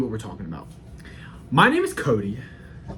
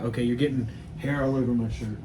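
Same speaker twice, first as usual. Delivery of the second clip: usual speaking voice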